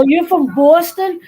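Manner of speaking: high, loud, Boston as "BAW-ston"; mocked Boston accent. Outsider asking a local where they're from, Boston as mocking "BAW-ston". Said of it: "make in fun of the Boston accent".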